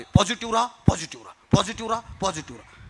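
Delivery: mock mantra. Speaker mocking positivity preachers to his audience, droning mantra. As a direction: chant it mockingly, alternately up and down, fading away.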